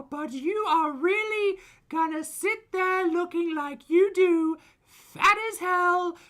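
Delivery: high, loud, slow taunting screech; mock her like a shrieking online bully; foodie voice. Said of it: "in foodie voice".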